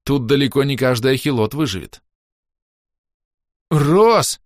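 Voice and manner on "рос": loud high wail of a name. A narrator's reading. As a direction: yell for help in panic. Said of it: "завопил понявший это шёпот".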